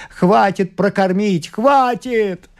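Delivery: high, drawn-out wailing cry; desperate cry. A narrator's reading. Father insists he can feed her, crying out desperately. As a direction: cry it up to the ceiling. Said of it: "кричал он в потолок".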